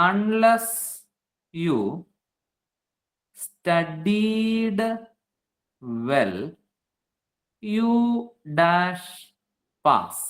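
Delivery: word by word with long pauses; spaced-out words, each held separately; slow dictation. Shown as a demonstration. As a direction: dictate the question slowly to a class. Teacher dictates a question to students.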